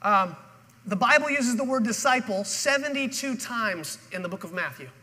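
ordinary talk, nothing marked

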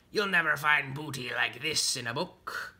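boastful pirate character voice; Calico Jack Rabbit. Acted. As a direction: crow over the loot like a pirate. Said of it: "Calico Jack Rabbit said".

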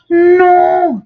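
high, loud shout. A narrator's reading. Le papa crie non au caissier, plus haut et plus fort. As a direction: shout it back harder. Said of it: "crie le papa".

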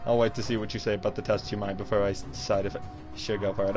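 normal talking voice

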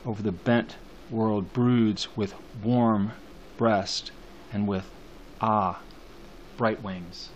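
slow, measured reading with long pauses; poem recitation. Acted. Teacher reading the poem's last line to students, slow recitation.

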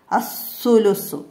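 അസ്സുലുസു read slowly as as-sulusu, the l of al becomes a doubled ss; al merged into s. Shown as a demonstration. Teacher slowly modelling അസ്സുലുസു for pupils, doubled ss. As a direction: stretch അസ്സുലുസു with a long doubled ss and no l. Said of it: "അസ്സുലുസു ചേർത്ത് ഓതണം".

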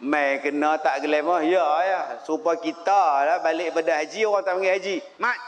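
normal talking voice

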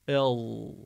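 "El" with a long heavy L-like Dad; side-tooth Dad. Shown as a demonstration. Teacher demonstrating Dad, heavy held "El". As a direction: press the tongue to the side teeth and hold the ending. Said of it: "dilimizi yan dişimize götüreceğiz".

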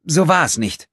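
high, loud protest of innocence. A narrator's reading. Accused girl to her accusers, insistent protest. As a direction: assert it high and forcefully. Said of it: "beteuerte sie".

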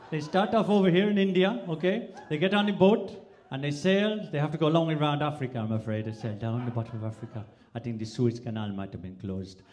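Indian-Welsh lilt building up; Indian to Welsh accent. Shown as a demonstration. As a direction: slide from Indian into sing-song Welsh. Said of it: "the Indians migrated to Wales".

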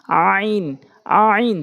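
"আইন" squeezed in the throat and drawn out; pressed throat pronunciation. Shown as a demonstration. Teacher tells learners to press, squeezed long "আইন". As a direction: press hard on the start of "আইন", then hold it. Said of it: "চাপ দিয়ে উচ্চারণ করবেন".

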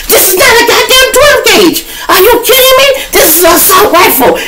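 shrieking very high, loud and stunned; freaking out. Retold or imitated. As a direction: lose it at the sight of the gun. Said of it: "man, he tweaking".

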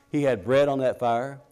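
usual speaking voice

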